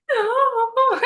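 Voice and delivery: high, loud, sobbing "No."; a wailing no through tears; retold cry, voice breaking high. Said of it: "And I'm crying".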